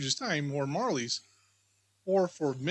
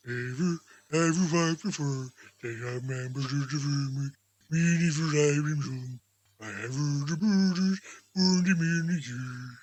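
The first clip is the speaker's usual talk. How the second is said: a booming jovial giant welcoming a guest; jolly giant spirit; 5 semitones lower than usual. Acted. Spirit answers Scrooge.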